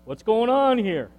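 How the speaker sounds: a hint of a startled blind father; confused old father. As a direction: sound thrown and alarmed. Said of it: "Isaac is confused".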